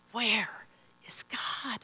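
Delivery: hushed, searching near-whisper; wondering. Acted. People on tiptoes looking out into history, softly wondering. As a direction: let the question fade into a whisper. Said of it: "and they're wondering".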